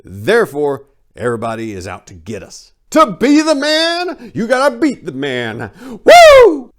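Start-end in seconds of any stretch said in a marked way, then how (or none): 2.9-5.7 much higher, louder and slow, hammed-up wrestling-promo bellow; wrestler promo voice. Ranting to viewers about fighting the man, hammed-up wrestler shout. 6.0-6.7 very high, very loud whooping yell; wrestler's woo. Pretend. Ranting to viewers about fighting the man, loud whooping yell.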